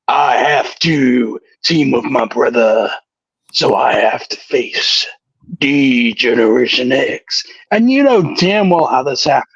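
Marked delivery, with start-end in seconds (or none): 0.1-7.4 low slow Undertaker impression. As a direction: rumble it out, lower and slow. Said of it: "He came back and said".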